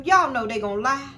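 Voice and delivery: normal talking voice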